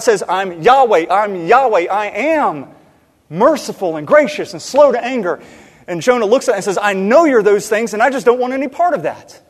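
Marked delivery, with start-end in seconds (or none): 0.3-5.4 loud, higher preaching proclamation; God proclaiming himself at full preaching force; emphatic divine declaration. Retold or imitated. Voicing God's declaration, preached forcefully. 6.8-9.4 loud, higher, exasperated rejection; defiant retort. Retold or imitated. Acting Jonah rejecting God.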